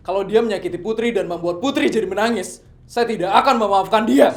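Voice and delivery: loud rising warning; angry threat. Acted. Father recalling his warning to King, angry. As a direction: vow it through anger.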